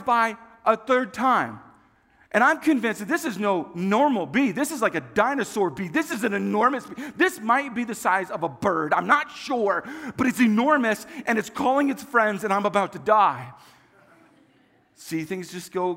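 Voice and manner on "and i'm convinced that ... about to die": hammed-up frantic dread, pushed loud; mock panic. Nine-year-old convincing himself a giant bee will kill him, mock panic.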